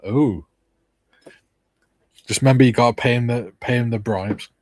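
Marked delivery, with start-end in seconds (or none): none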